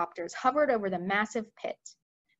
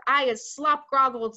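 louder squawk, a bit higher; squawking giant; 4 semitones higher, 6 dB louder than usual. Acted. Gizzardgulper squawking from the ground, louder. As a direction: squawk it like a flustered brute. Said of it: "squawked the gizzard gulper".

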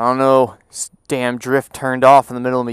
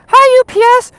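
very high, loud falsetto; piercingly high hello; squealed greeting; 11 dB louder than usual. Pretend. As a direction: call out in a silly high voice. Greets a passing truck.

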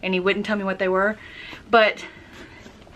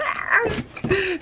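high-pitched giddy squeal-laugh; excited high laugh; 11 semitones higher than usual. Real, not acted. Collector thrilled about the gift, giddy high laugh. Excited noise after saying she's excited.

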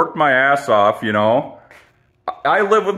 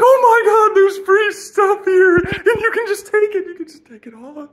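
much higher, squealing over free stuff; giddy excitement. Pretend. Finding free stuff all over the place, high giddy squeal. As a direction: gush like you just found treasure on Craigslist. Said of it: "You'll be like".

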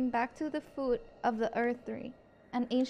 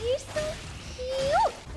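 adoring coo; 10 semitones higher, much slower than usual. Real, not acted. Cooing over a cute little creature. (vocecita aguda de cariño)

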